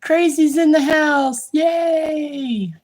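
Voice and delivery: high-pitched welcoming cheer; high, celebratory hooray; gleeful cheer. Real, not acted. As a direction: cheer their arrival with a long, drawn-out yay. Cheering a viewer joining the live.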